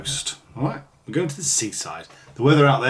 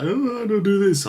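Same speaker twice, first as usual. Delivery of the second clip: posh brush-off; 10 semitones higher, 5 dB louder than usual. Pretend. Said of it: "I'll be like".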